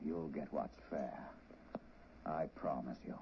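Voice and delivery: low, slow veiled threat; quiet menace, slow and low; menacing. Acted. Gangster quietly threatens her.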